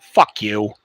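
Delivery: low, blunt mock retort. Walking out on the teacher, low blunt retort.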